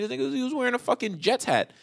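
ordinary talk

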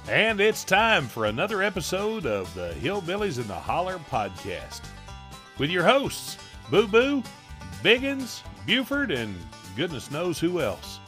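big hillbilly announcer voice over music; show intro announcer. Acted. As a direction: announce the show like a country radio host.

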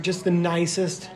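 normal talking voice

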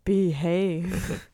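half-hearted catchphrase impression; Austin Powers. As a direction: toss off a badly faked spy-spoof voice. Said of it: "trying to do a Austin Powers impression".

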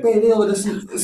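high, hammed-up imitation of wife, breaking into laughter; mocking wife. Husband imitating wife's alarm, high laughing mockery. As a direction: mimic her alarmed voice and crack up. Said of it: "the way she said it".

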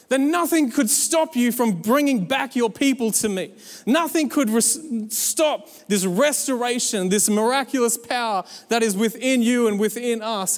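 impassioned worship, pitched high and emphatic; awed worship. Acted. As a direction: declare praise to God in awe. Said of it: "we can just stand in awe, going".

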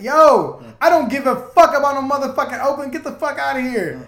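loud, high, furious retort acted out; retold angry outburst. Retelling how he told the club girl off, heated.

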